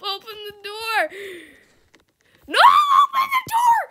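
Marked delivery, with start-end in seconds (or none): 2.5-3.8 shrieked high through laughter; laughing shout. Pretend. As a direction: scream it while cracking up. Trapped, begging to be let out.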